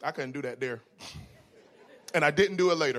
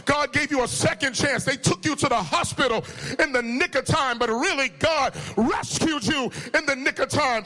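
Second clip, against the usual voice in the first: loud, raised, driving sermon delivery; full-force preaching; 4 semitones higher, 4 dB louder than usual. Real, not acted. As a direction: preach it with rising fervor.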